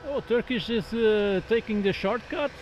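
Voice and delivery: ordinary talk, nothing marked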